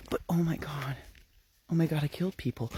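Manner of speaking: whispered, rushed dawning panic; panicked whisper. Retold or imitated. Jessica whispers in panic after killing police officers. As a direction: breathe it out fast in disbelief. Panicked killer murmuring to herself.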